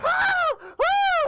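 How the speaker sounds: piercing, very high loud shriek; cartoon scream. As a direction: scream at full pitch like a terrified cartoon. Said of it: "the screaming is still going on".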